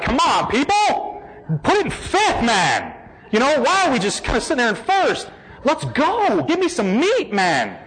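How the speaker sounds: high, eager mock complaint; impatient. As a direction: urge them on, hammed-up frustration. Impatient with dull RCIA class.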